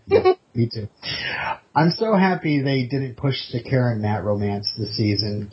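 normal talking voice

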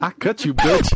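loud, high playful threat; mock threat. Joking threat to a boyfriend's rival, hammed-up menace. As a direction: burst out like a pretend street fighter.

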